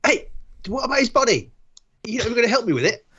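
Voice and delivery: indignant protest. Acted. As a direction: call out in disbelief, rushing the second question. Character protests as others walk off.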